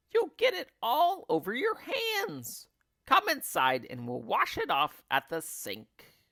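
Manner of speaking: high nagging hag voice; high bossy old woman. Acted. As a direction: order him about shrilly. Missus Twit voiced.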